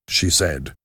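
plain reading voice

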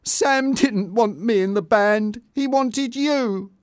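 high, upset realisation; distressed, slowing on the painful conclusion; very high, wounded tone; shocked. A narrator's reading.

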